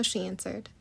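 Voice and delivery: ordinary delivery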